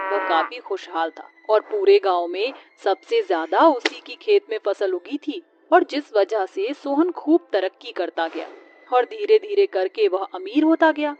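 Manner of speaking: plain reading voice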